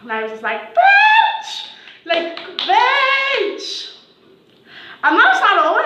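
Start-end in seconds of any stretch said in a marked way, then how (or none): 0.7-1.7 very high, loud victorious shriek; triumphant exclamation. Retold or imitated. 2.6-3.9 high, drawn-out gloating cry; gloating exclamation. Retold or imitated. Repeated exclamation.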